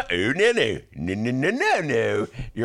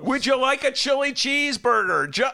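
higher, sugary mock offer; mock Biden offering a cheeseburger; Joe Biden impression. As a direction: pamper the judge. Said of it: "Clarence Thomas one".

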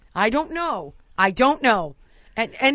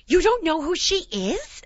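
higher-pitched imitation of an incredulous mother; mock maternal disbelief, pitched up; bragging mom; 7 semitones higher, 4 dB louder than usual. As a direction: play mom astonished he doesn't know. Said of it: "And my mom goes".

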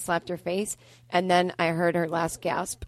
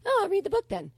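much higher mimicking voice; high-voiced talk show host; 12 semitones higher than usual. Comic quoting the host to producer, high mimicking voice. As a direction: imitate the host in a high, squeaky-pitched voice. Voices Jay Leno agreeing to read.

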